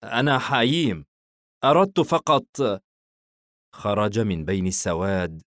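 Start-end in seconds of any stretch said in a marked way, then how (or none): 0.0-2.5 higher-pitched, a touch of nerves; mildly tense, voice lifting as the explanation starts; nervous. A narrator's reading. Hayeem answers the unseen voice in the dark, nervously. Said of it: "حاييم بتوتر".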